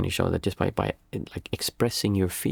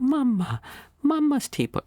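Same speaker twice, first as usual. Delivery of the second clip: much higher, imitating a sad little boy; crying toddler. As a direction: wail it like an upset two-year-old. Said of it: "my son started crying. He's like".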